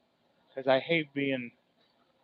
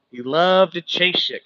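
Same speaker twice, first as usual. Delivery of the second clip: louder and slower, leaning on loved; loud repeat; 5 semitones higher, 9 dB louder, slower than usual. Shown as a demonstration. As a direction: repeat it so the back of the room hears. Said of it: "Can y'all still hear me?".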